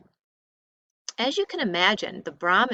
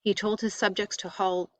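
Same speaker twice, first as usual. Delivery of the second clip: ordinary delivery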